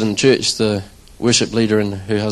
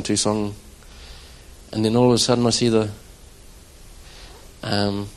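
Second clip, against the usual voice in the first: ordinary talk, nothing marked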